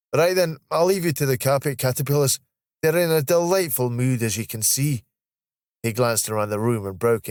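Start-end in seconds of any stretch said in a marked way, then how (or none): 0.1-5.0 higher, lively Scottish voice; breezy Scottish banter; wry Scottish colleague. Acted. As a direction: hand over with playful Scottish irony.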